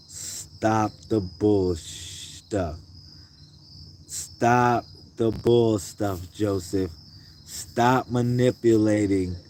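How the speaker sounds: slow, stretched-out words at normal volume; slow, deliberate scolding; drawn-out telling-off. Real, not acted. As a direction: stretch each word in weary reproof. Mock telling-off of crying son.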